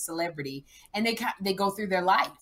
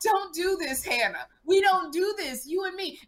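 mock pleading mom; 8 semitones higher than usual. Mom pleading in retold scene.

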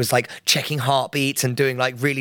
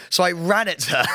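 ordinary talk

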